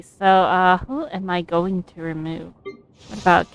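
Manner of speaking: normal talking voice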